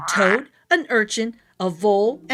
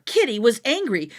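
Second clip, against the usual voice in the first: ordinary delivery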